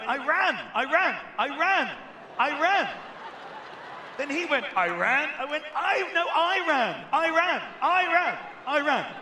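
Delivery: loud, high, breathless yelling of I ran; frantic shouting. Retold or imitated. Comedian to the embassy official, frantic high shouting.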